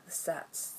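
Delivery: unvoiced the sets in awe; whispered awe. Real, not acted. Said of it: "repeats word".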